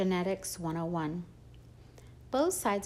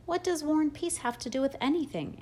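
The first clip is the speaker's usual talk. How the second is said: a higher-pitched, annoyed retort; 8 semitones higher, 4 dB louder than usual. A narrator's reading. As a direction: mom snapping back at dad. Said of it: "clearly annoyed".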